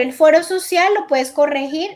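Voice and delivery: ordinary talk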